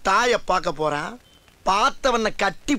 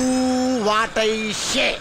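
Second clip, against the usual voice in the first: very slow, stretched-out order; drawn-out command; much slower than usual. Acted. Constable pulling rank on colleague, drawn-out command. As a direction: drag out each word imperiously.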